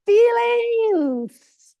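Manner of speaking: high, loud shouted word; a mock-dramatic cry across the room; emphatic shout. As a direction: shout it so Justin hears it wherever he is. Repeats word to Justin, shouting.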